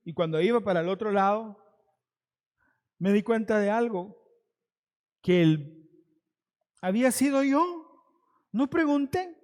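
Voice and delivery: ordinary delivery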